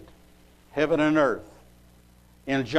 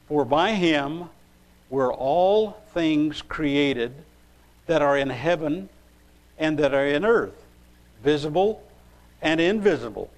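scripture reading. Acted. Preacher reading Colossians one sixteen, scripture reading. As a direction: read it out deliberately, pausing after each phrase.

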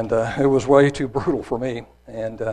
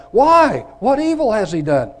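high, forceful question with a loud opening; Pilate's protest; 9 semitones higher, 7 dB louder than usual. A narrator's reading. Pilate pleads a third time with crowd, raised protest. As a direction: challenge them, voice raised. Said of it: "said unto them the third time".

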